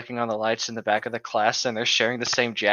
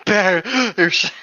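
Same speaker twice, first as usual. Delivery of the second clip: mock gasped shout; 8 semitones higher, 5 dB louder than usual. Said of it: "gasping the word bears as a question".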